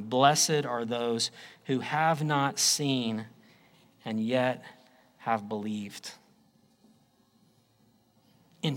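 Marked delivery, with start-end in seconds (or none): 0.0-6.1 slow, soft, fading lower; hushed scripture. Acted. Preacher reading Jesus's blessing softly to the congregation. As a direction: read Jesus's blessing gently, slowly.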